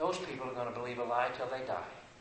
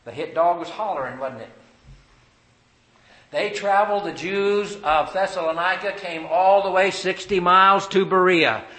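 loud, higher, driving sermon voice; full-force preaching; 4 semitones higher, 11 dB louder than usual. Acted. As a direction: hammer the verse at the congregation.

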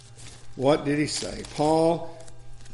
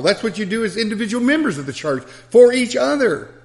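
building, higher and louder preaching; 6 semitones higher, 5 dB louder than usual. Acted. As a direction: drive it home to the congregation, climbing. Rising preaching on fighting for truth.

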